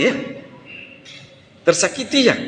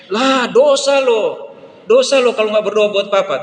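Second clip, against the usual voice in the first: put-on taunting child; 6 semitones higher, 4 dB louder than usual. Child taunts friend in story.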